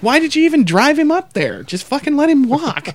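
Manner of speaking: high, sarcastic disbelief; mock incredulous. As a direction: scoff at the parents. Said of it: "I'm like".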